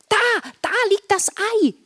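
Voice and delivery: high, excited shout of discovery; excited call. A narrator's reading. Marla spots the egg in the park, excited high call. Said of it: "rief Marla aufgeregt".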